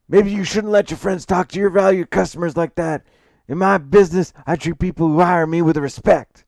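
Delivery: high, loud character voice of an offended client; indignant scolding. Acted. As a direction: lecture him angrily at full volume. Client scolds accountant angrily.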